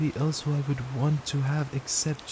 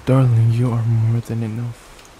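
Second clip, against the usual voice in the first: louder, insistent "Darling"; emphatic reassurance; 2 semitones lower, 8 dB louder than usual. Acted. Boyfriend insisting to girlfriend she is more than enough, loud and firm. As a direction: push it out firmly, stressing "Darling".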